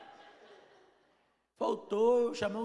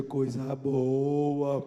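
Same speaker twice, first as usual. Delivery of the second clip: "Coisa boa" stretched long and low, savouring it; slow, drawn out; 6 semitones lower, 5 dB louder, much slower than usual. Retold or imitated. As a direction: drag out "Coisa boa" slowly with satisfaction. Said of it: "repeated reply, drawn out". ("Coisa boa" bem arrastado e lento, saboreando)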